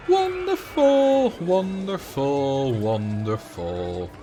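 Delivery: slow sarcastic sing-song sigh of wonderful. Real, not acted. Coaster already in the way.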